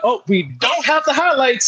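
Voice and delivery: high, flustered on-air save; flustered ad lib. Retold or imitated. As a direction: cover the gap in a fluster. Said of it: "I had an ad lib".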